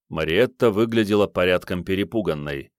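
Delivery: ordinary delivery, nothing marked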